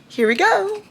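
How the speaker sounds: high, drawn-out launch; excited kickoff. Real, not acted. Reader starting King, excited high kickoff. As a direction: stretch it out high as you dive in. Dives back into the book.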